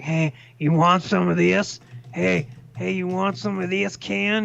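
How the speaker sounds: lightly put-on goofy impersonation; Bullfrog. Retelling a streamer's bit for Ken, lightly voiced impersonation. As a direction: imitate someone clowning into the mic. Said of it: "pretending he's Bullfrog".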